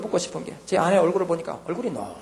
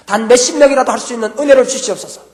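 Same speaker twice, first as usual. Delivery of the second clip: fervent prayer plea; 7 semitones higher, 13 dB louder than usual. Retold or imitated.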